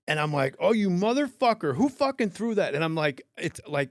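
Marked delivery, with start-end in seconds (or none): 0.6-2.7 high, loud retold outrage; mad. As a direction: re-enact an angry demand to the crowd. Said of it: "You're mad".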